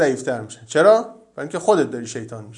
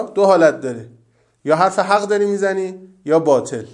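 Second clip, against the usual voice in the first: ordinary talk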